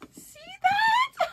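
high, loud, slow squealing; excited shriek. Real, not acted. Showing off her new bag, squealing loud and high. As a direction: squeal over the bag, laughing.

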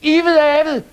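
high, loud shouted title; screamed. Acted. Children shouting which story they want, high loud yell. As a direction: shout out your favourite story. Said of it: "skreg nogen".

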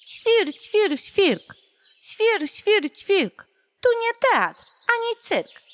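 high, loud indignant chirping; a cross little bird scolding; chirping sparrow. A narrator's reading. The sparrow scolds the showy nightingale, loud and high. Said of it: "Na to wróbel zaterlikał".

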